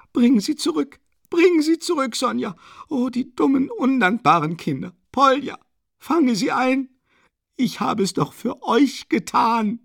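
very high, loud desperate cries; frantic screaming mother. A narrator's reading. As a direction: shriek after the fleeing children. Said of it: "Mit Geschrei und Weinen".

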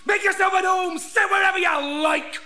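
loud, high sarcastic yell; shouting. Acted. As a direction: bellow the welcome rudely. Interviewer yells sarcastically.

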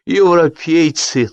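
put-on high, slow, drunkenly forced start; with visible effort. A narrator's reading. Drunk host forcing out a toast, labored. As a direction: push each word out with effort. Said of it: "начал он с видимым усилием".

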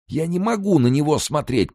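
high, wailing moan; horrified moan. A narrator's reading. Cellmate recoiling from the corpse, moaning. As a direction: moan it out in revulsion. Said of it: "простонал тот".

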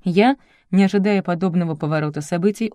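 ordinary delivery, nothing marked

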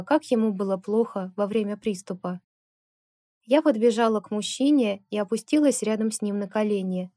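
ordinary delivery

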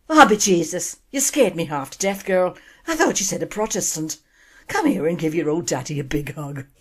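Irish father relieved his daughter isn't Protestant. Acted. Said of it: "excuse the accent".

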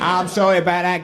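ordinary talk, nothing marked

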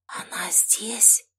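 slow, unvoiced stunned whisper. A narrator's reading. Stunned mother asks son about the girl, whisper. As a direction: whisper the question slowly. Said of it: "произнесла шёпотом".

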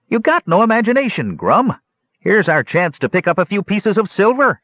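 high, quick, scheming goblin; sly goblin voice. Acted. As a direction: wheedle it like a greedy goblin. Goblin urges his partner.

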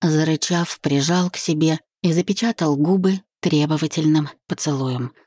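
plain reading voice

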